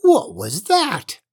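high, loud, slow witch's screech; shrieking old witch. A narrator's reading. Witch questioning her cat about the noise, shrieking. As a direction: demand it in a startled witch shriek. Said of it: "shrieked the old witch".

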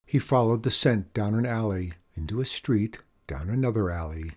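plain reading voice

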